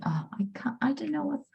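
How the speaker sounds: whispered under her breath; whispering. Real, not acted. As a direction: whisper it to yourself. Trying again, muttering to herself.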